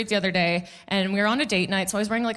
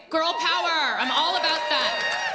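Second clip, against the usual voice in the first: peppy cheer; 7 semitones higher than usual. Real, not acted. Cheering women on.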